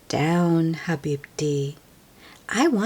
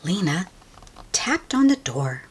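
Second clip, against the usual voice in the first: usual speaking voice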